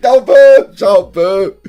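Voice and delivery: excited outburst. Real, not acted. Overwhelmed by the reveal.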